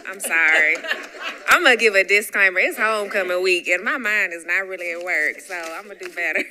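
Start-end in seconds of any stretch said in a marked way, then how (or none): 0.1-4.7 loud laughing apology; real laughter through a quick disclaimer. Real, not acted. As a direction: laugh it off loudly after a slip. Laughs at own slip.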